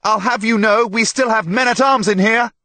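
very high, loud threat; indignant yell. A narrator's reading. Herald threatens pilgrims with men-at-arms, yelling indignantly. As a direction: warn them off at the top of your voice.

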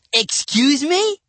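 high, drawn-out question; incredulous. A narrator's reading. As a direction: stretch it out in disbelief. Boy feigns incomprehension.

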